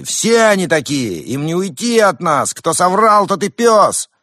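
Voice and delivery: high, loud crowd shouts; shouting. Acted. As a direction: yell from the crowd. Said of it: "Выкрики".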